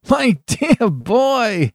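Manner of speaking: high, drawn-out amused exclamation; tickled, exclaiming to a dear friend; hugely amused. A narrator's reading. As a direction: swoop up high and stretch it in amusement. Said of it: "burst into a roar of laughter".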